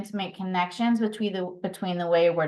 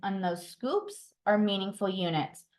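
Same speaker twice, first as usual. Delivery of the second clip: usual speaking voice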